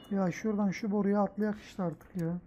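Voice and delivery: ordinary talk, nothing marked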